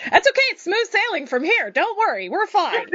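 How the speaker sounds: high, giddy reassurance; nervous laughing. Real, not acted. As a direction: laugh it off giddily. Relief after a good roll.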